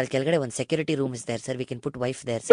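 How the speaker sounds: low, soft, quick deferential patter; meek broker. Acted. Broker passes on owner's odd proposal, meek low murmur. Prank caller as broker Nagesh.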